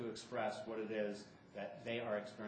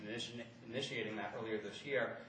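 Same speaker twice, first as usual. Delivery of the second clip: ordinary talk, nothing marked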